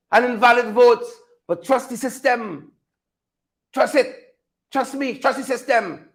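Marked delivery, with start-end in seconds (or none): 1.5-6.1 soft, sneering "trust the system" refrain; mock hushed slogan. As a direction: murmur the slogan like a mocking chant. Sarcastic refrain, mocking the slogan.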